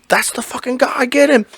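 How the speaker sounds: high mock yell of an accuser; a pretend onlooker spotting the suspect, high; imagined accusing student. Student pointing him out in the school hallway, mock yelling. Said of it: "they're gonna be like".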